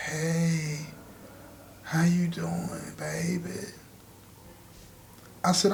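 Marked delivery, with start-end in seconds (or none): 0.1-3.7 barely audible, slow and soft, like someone in a hospital bed; a weak, breathy-sounding patient voice, very soft; faint dying voice. Retold or imitated. Sister fakes weak dying voice.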